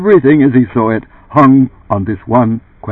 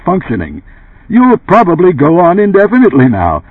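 loud, wounded indignation, building higher; indignant; 10 semitones higher, 6 dB louder than usual. Acted. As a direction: defend yourself, hurt and outraged. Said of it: "with heated indignation and surprise".

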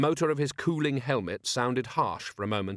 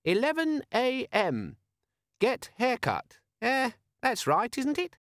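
very high, slow chirpy device voice; chirpy little machine reminder; cheerful gadget voice; 13 semitones higher, slower than usual. Acted. Imp organizer device speaking.

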